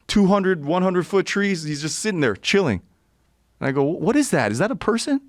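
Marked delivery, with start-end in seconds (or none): 3.8-5.2 can't believe what you're seeing; incredulous. Retold or imitated. Seeing a man in a tall tree, rising high in disbelief. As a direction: pitch climbing high in disbelief.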